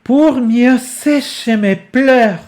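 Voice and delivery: projected, lifted reading of the lyric. Shown as a demonstration. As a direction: declaim it with growing volume. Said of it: "at least in volume".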